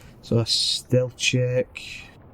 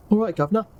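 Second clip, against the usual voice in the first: higher-pitched stock Cockney greeting; Cockney accent; 9 semitones higher, much faster than usual. Pretend. Player trying out [name]'s best Cockney accent, put-on Cockney. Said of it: "do your best Cockney accent".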